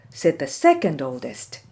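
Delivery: plain reading voice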